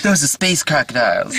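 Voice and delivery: impression of an impression, squeaky and silly. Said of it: "that's my impression of [name] doing his impression".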